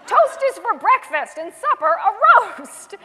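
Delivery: loud laughing read. Real, not acted. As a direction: laugh through the line. Laughing while reading.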